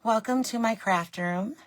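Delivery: normal talking voice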